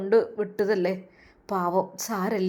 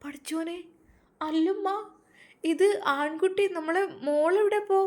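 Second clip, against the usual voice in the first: higher, astonished questions; surprised; 7 semitones higher than usual. Acted. Surprised daughter asking mother about the baby boy. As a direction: gasp it out in disbelief. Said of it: "അത്ഭുതപ്പെട്ടു".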